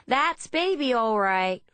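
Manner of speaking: high, loud Texan woman; Texan accent. A narrator's reading. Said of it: "in an accent similar to Deborah's Texan friend".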